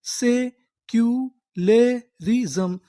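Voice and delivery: very slow, rising; slow teaching model; slow syllable-by-syllable reading. Shown as a demonstration. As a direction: sound out secularism in parts. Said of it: "तो हमारी पूरी meaning क्या बनी?".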